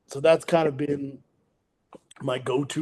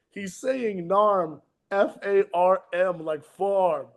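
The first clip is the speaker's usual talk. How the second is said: slow explaining; 4 semitones higher, slower than usual. Retold or imitated. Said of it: "I go".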